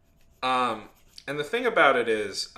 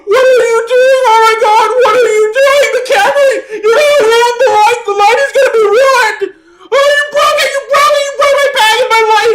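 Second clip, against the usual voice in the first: screeching, very high panicked imitation; freaking out; 18 dB louder than usual. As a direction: scream it as if your precious gear is being destroyed. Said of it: "he freaks out at me".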